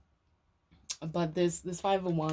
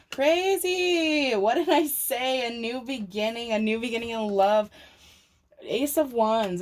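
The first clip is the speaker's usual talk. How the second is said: much higher, louder thrilled exclamation; real excitement; 5 semitones higher, 6 dB louder, slower than usual. Real, not acted. Tarot reader reacting to a card that fits her earlier prediction, excited. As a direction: burst out, thrilled the card matches.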